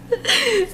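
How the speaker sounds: high nervous giggle; scared laughing burst. Real, not acted.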